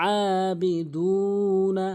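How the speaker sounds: আবিদু with the long vowel stretched very slowly; long vowel recitation. Shown as a demonstration. Teacher stretching a long vowel for students. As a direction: draw out আবিদু, holding the vowel. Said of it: "এক আলিফ টেনে পড়তে হয়".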